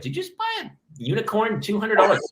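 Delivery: hammed-up what is going on here; incredulous disbelief. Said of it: "I was like".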